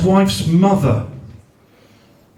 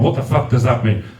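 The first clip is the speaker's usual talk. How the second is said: ordinary talk, nothing marked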